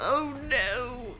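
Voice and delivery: high, drawn-out wail of dismay. Real, not acted. Player watching danger close in, dismayed wail. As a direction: wail it high without extra volume.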